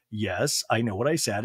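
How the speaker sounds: low, curt imitated reply; kind of annoyed. Garcelle answers Anna Marie, kind of annoyed, low. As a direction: drop the pitch, mildly irritated. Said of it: "kind of annoyed, goes".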